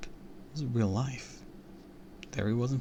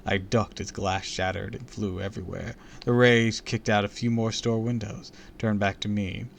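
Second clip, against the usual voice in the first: plain reading voice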